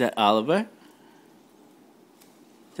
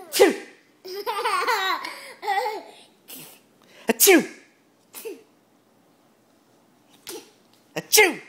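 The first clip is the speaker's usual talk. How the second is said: fake sneeze. Fake sneezes to amuse Oliver.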